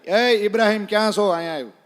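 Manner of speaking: loud yell for Ibrahim; shouting. Retold or imitated. Pathan yells for Ibrahim at the wedding, a loud shout. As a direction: bellow across the wedding crowd. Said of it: "ન્યા રાડ પાડી".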